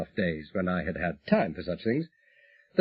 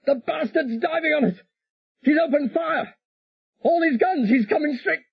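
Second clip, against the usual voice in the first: much higher, frantic warning cry; urgent panicked shout; 4 dB louder than usual. A narrator's reading. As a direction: yell the alarm as guns open up. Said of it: "harsh, urgent shout from Pete".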